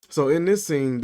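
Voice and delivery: ordinary talk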